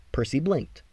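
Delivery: ordinary delivery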